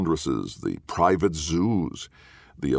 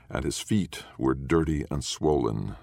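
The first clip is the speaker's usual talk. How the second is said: ordinary delivery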